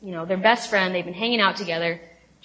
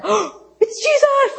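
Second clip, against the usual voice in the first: gasped, high, drawn-out mock cry of recognition; stoked; 14 semitones higher, 4 dB louder, slower than usual. Disciples suddenly recognizing Jesus from the boat, stoked high cry. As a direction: squeal it slowly, imitating thrilled disciples. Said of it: "Like, just stoked".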